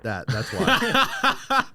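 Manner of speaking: laughing through the words. Real, not acted. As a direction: break into laughter mid-line. Joke about loud voice.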